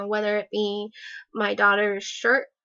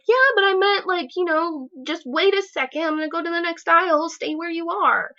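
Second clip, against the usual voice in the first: higher and louder, exasperated explaining; mad; 8 semitones higher, 4 dB louder than usual. Retold or imitated. Arguing with the family member in the store, higher and exasperated. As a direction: snap back, frazzled and irritated. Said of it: "like, mad".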